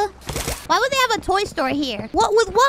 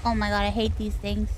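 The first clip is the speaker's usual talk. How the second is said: ordinary talk, nothing marked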